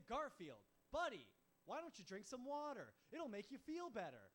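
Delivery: loud, high-pitched desperate plea; exasperated pleading. Acted. Owner to wasted Garfield on Sunday, loud exasperated pleading. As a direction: shout it at a drunk pal, at wit's end.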